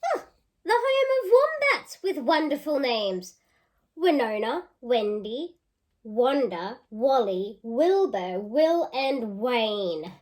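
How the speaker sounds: lively storybook reading; reading to children. Acted. Teacher reading a picture book aloud to children. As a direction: read it out with relish for the kids.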